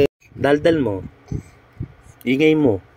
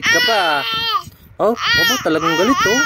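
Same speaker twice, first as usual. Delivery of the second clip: put-on much higher, teasing baby-talk voice; squeaky baby talk. Mother teasing her angry baby in baby talk.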